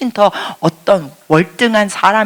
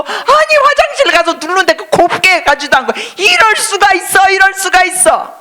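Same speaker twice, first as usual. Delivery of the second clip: high, loud exasperated outcry; pitched-up, loud indignation; indignant complaint; 13 semitones higher, 5 dB louder than usual. Retold or imitated. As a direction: vent the outrage at the roommate. Indignant retelling of roommate.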